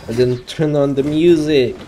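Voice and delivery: ordinary talk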